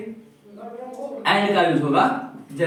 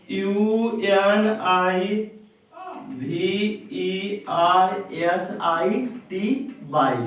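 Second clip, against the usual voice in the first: each letter of the spelling drawn out slowly; much slower than usual. Shown as a demonstration. Teacher to class, spelling university letter by letter slowly. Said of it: "इसका spelling अगर देखा जाए".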